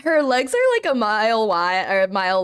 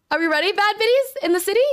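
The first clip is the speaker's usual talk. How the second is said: hype call; 9 semitones higher, faster than usual. Real, not acted. Hyping up stream audience.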